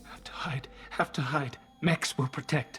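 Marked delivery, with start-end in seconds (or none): 0.0-0.7 breathy terrified whisper, hiding; frightened whisper. Acted. Delirious survivor whispering to Shepard from his hiding place, frightened whisper. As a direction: whisper it like you're hiding from monsters.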